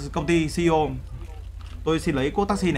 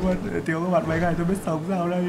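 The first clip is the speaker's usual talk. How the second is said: hammed-up crying through the words; mock sobbing. Streamer missing his viewers already, mock sobbing. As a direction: draw it out as a fake tearful farewell.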